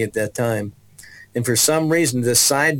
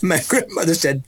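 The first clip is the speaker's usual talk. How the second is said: usual speaking voice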